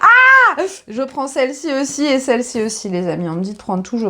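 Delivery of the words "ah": a sudden high, loud exclamation; surprised cry. Real, not acted. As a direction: burst out in delighted surprise. Exclaims on drawing a card.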